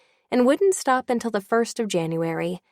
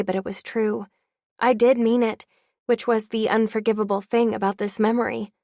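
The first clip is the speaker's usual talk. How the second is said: ordinary delivery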